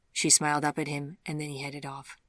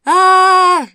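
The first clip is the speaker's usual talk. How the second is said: high, very loud scream of fear; horrified cry; 20 dB louder than usual. A narrator's reading. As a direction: cry out in sudden horror. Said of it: "fear and horror filling her voice".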